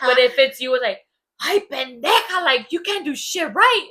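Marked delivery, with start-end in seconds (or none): 1.4-3.9 high, loud, drawn-out mock exasperation; mock parental scolding. Imitating a parent after the kid's small mistake, mock scolding. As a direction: imitate a parent berating their kid, slowly and loudly.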